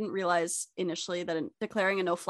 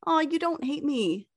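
relieved quote; 8 semitones higher than usual. Retold or imitated. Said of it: "I felt like".

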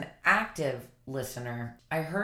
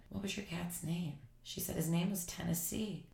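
near silent, tender; hushed tender question; 8 dB quieter than usual. Retold or imitated. Holding the grieving woman's hand, asking her cat's name hushed. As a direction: ask it gently, barely there. Tender exchange while crying.

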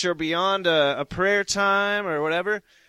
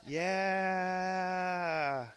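usual speaking voice

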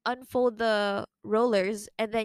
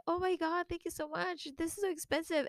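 high, effusive thanks; grateful gushing; 7 semitones higher than usual. Retold or imitated. Thanking the giver for a pricey gift, high and effusive. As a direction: thank her with delight. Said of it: "And I was like".

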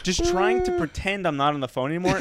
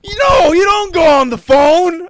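high, full-force shouted order; imitated yelling; 13 semitones higher, 12 dB louder than usual. Border guard scolds traveler for phoning, shouting. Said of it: "this guy keeps on yelling".

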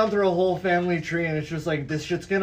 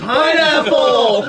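loud, high, drawn-out safe word; hammed-up shout, pitched up and stretched; mock desperate yell; 5 semitones higher, 12 dB louder, much slower than usual. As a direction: yell it out long, laughing. Acted panicked safe word, yelled.

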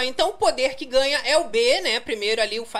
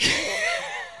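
gargalhada aguda. Real, not acted. Laughs after asking for calm.

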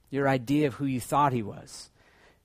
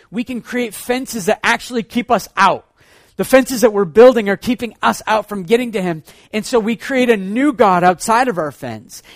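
louder, climbing sermon about fences; preaching at full force; 4 semitones higher, 8 dB louder than usual. Acted. Preacher warning the congregation about fences, at full force. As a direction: drive the point home from the pulpit.

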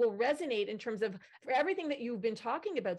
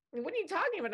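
lightly voiced teenager, pitched up high; baffled teen; 9 semitones higher, faster than usual. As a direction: imitate a puzzled teen's high-pitched question. Retold baffled question from teenage daughter.

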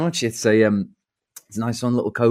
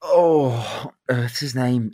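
ordinary talk

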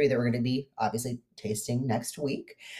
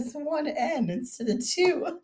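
high, slow mock scandal voice; mock gossip; slower than usual. Teasing her friend's secret spelling in a high gossipy voice. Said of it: "Use your inside voice".

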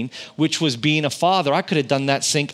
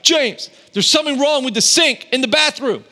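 mock-aggressive demand, much higher and louder; aggressive wife; 10 semitones higher, 4 dB louder than usual. Wife telling husband the sink is broken, mock aggressive. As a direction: bark it like an annoyed wife. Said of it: "that was a really aggressive tone of my wife".

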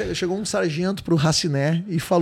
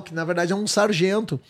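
normal talking voice

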